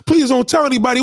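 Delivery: imitating someone begging not to be exposed; 1 mock pleading. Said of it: "He was begging him".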